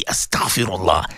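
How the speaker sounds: a low, devout "Astaghfirullah" three times; low devout recitation. Shown as a demonstration. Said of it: "make, say".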